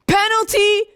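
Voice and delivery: normal talking voice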